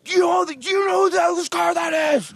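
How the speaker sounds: high, loud, slow scolding yell; bouncer shout. Retold or imitated. Bodyguard scolding the guy leaning on the Cadillac, angry shout. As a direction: yell it down at him, outraged.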